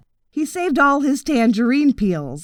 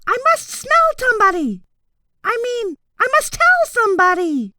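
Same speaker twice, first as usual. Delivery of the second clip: very high, hurried little mouse voice; flustered mouse rushing off to tell; mouse muttering to itself; 12 semitones higher, 3 dB louder than usual. A narrator's reading. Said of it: "hurried away muttering to itself".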